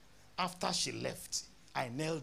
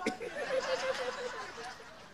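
high burst of laughter; real high laugh. Real, not acted. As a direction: laugh at your own thought. Laughter at own thought.

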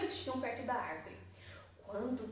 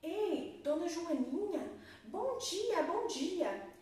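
high, cheerful snake greeting; 8 semitones higher, much slower than usual. A narrator's reading. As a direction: call out brightly and high. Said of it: "e falava assim".

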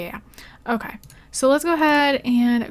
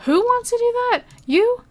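high-pitched, incredulous questions; high squeal of a question; 12 semitones higher, 5 dB louder than usual. Real, not acted. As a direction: ask it in high disbelief. Reacting in disbelief to game character.

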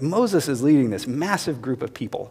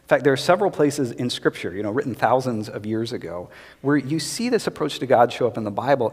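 usual speaking voice

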